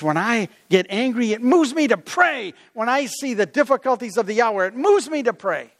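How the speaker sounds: impassioned, pitch climbing to the end; angry zeal. Acted. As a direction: preach it with rising righteous fervor. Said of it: "he became angry and zealous".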